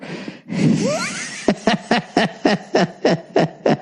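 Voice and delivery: high staccato cackle after a wheeze; wheeze, then let out a long run of high ha-has; real laughter. Real, not acted. Laughs at his own story.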